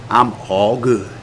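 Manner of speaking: slow, low, soft 'all good'; dismissive brush-off. Acted. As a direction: shut the question down, low and drawn out. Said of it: "instead of going".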